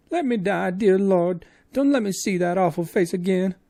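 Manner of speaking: high, loud, despairing wail; terrified woman crying out; wailing frightened maid. Acted. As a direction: moan it with eyes shut tight.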